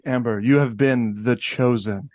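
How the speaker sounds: low, lightly portentous announcement; mock-solemn proclamation. Host imagines the casting call to the actress, mock-solemn.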